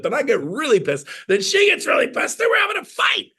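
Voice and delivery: escalating mock quarrel. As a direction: pitch climbing to a high mock shout. Acts out an escalating marital quarrel.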